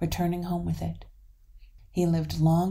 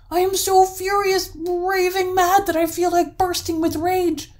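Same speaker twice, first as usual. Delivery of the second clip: high, sustained raving rage; enraged troll yelling every phrase; furious yell; 13 semitones higher, 9 dB louder than usual. A narrator's reading. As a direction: rant at full volume, bursting with rage. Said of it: "yelled the troll furiously".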